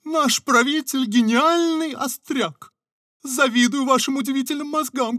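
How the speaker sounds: high, loud fawning praise; flattering courtier. Acted. (льстиво, высоким голосом)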